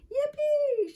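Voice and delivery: high, loud whoop; excited shout. A narrator's reading. Michael rushes to clean up toys, excited shout. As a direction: cheer at the top of a child's voice. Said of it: "shouted Michael".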